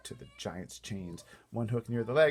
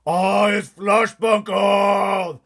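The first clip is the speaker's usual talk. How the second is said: much higher, much louder, slow bellowing giant; giant's roar; 12 semitones higher, 18 dB louder, much slower than usual. Acted. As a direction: roar it out like a trussed-up giant in a rage. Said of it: "roared the flesh lump eater".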